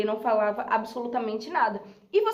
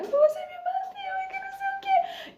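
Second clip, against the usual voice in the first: very high, whining mock sob; telenovela fake crying. Pupil putting on a soap opera for the teacher, fake crying. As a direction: squeal it through put-on tears. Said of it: "comecei a, a minha novela mexicana".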